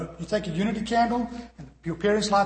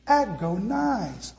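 slow drawn out; much slower than usual. Shown as a demonstration. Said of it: "I'm gonna say it slowly".

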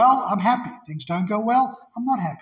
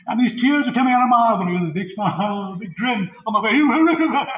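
laughing while telling; 4 semitones higher, 5 dB louder than usual. Real, not acted. Laughing retelling of spanking.

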